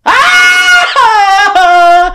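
high, loud shriek of horror; horrified cry; shocked scream. Real, not acted. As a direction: scream at what he just did. Friend eats the cigarette.

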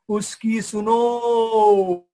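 very slow, booming, drawn-out command; voice from heaven. Acted. A voice from the sky tells the leaders to listen, slow and booming. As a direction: boom it down from the sky like God speaking. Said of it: "आसमान से एक आवाज आया".

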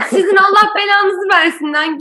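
high, loud imitation of a cursing woman; mock angry caller. Mocking the angry caller's curse to a friend, loud and high. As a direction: throw the curse like the furious caller. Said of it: "Bas bas bağırınıyor telefonda".

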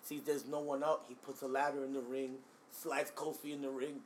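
ordinary talk, nothing marked

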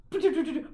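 loud imitated ding; imitated bing. Mimicking the ring appearing on her finger, comic bing. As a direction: make a bright ringing noise by mouth.

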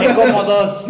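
high, laughing mock line. Friend voicing the customer's joke, high and laughing. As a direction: squeak it out while laughing. Joking voiced quote.

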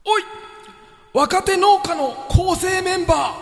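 slow, loud, high raspy shout; hoarse voice. Shown as a demonstration. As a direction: bark out each line like a gruff old farmer. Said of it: "しゃがれた声で言います". (ゆっくりしゃがれた声で叫ぶ)

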